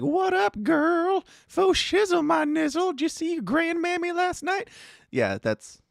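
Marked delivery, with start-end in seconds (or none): 0.0-4.7 put-on very high, silly old lady voice. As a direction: squeak out the slang like a clueless granny. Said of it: "she's like".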